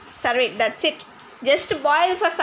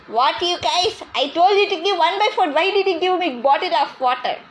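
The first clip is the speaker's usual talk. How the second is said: higher and louder, exasperated grandma scolding; angry scolding; 4 semitones higher, 3 dB louder than usual. Acted. Grandma scolding grandson over too much water, exasperated and loud. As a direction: let the complaint rise in pitch and volume, fed up.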